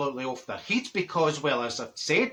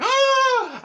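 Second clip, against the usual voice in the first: high, loud imitated gasp; collective gasp; 6 dB louder than usual. Family seeing her make an entrance, exaggerated gasp.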